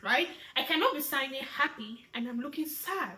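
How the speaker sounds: usual speaking voice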